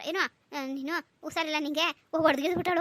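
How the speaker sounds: ordinary delivery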